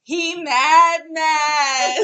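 loud, laughing "he mad" taunt. Pretend. Taunting him on TikTok, loud and laughing. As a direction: taunt him loudly for the camera. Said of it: "We get on TikTok like".

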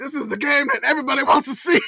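high mock outraged yell; imitating an outraged fan; mad fan yelling. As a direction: rant like the angry fan. Quoting the angry fan.

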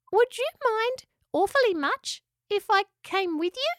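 very high, tentative squeaky voice; hesitant little echidna. A narrator's reading. Spike asks to come along, hesitant little echidna. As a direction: ask shyly, pausing between phrases. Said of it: "asked Spike hesitantly".

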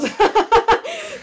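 high, loud laughing burst; sudden high cackle. Real, not acted. Laughing at own hat aside.

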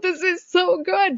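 slow, high, pleased inner voice; inner thought. Retold or imitated. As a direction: savor it as a happy surprise. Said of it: "I'm thinking to myself".

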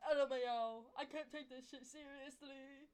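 high, drawn-out mocking imitation; mock quote. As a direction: mimic them loudly, stretching out the words. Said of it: "and, yeah, and you say".

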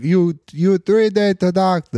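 put-on hammy Indian accent, loud; bad Indian accent. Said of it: "that's the worst Indian accent ever".

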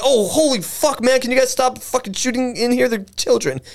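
high-pitched mock panic; imitated panicked cursing. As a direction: plead in a high, hammed-up panic. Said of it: "He's not saying, like".

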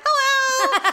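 high, sing-out nerdy greeting; Ned Flanders. Retold or imitated. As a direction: mimic a dorky, over-friendly neighbour. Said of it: "He's like Ned Flanders".

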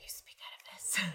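soft, airy whisper; hushed close-mic whisper; breathy whisper. Shown as a demonstration. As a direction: demonstrate whispering on pure breath. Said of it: "Do it breathier".

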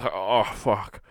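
overdone pleasure moans in the chair; mock massage moaning. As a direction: ham up someone melting under a massage. Mimicking massaged person's moans.

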